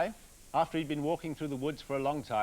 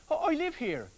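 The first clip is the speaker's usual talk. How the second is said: tree man pleading; 12 semitones higher, 6 dB louder than usual. Acted.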